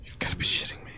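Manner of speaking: whispered disbelief. Real, not acted. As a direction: breathe it out in a whisper.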